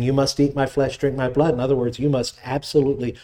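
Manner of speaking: ordinary delivery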